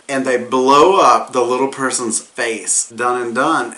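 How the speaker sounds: ordinary talk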